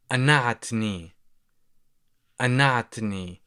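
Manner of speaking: anatni said slowly syllable by syllable; slow demo. Shown as a demonstration. Teacher slowing anatni down so learners can follow. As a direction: spell out anatni slowly with a glottal stop. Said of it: "you would say".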